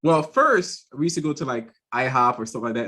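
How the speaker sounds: ordinary delivery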